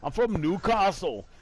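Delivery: mock indignant Newcastle accent, pitched high; high-pitched put-on Newcastle accent; pissed-off Newcastle man. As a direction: imitate an angry Geordie passenger. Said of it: "he was, he was pissed".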